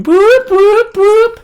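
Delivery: beeping detector. Acted. Metal detector going off at Tobert, high beeps.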